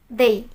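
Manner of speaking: soft, low "they" with dal-like th; correct English "they". Shown as a demonstration. Teacher models the lisped sound, soft "they" with th. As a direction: say "they" softly, th between z and dal. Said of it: "Jadi ngomongnya kayak".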